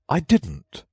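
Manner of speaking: loud, high, quick denial; hurried denial. A narrator's reading. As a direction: blurt it out in a rush. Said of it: "interrupted in a great hurry".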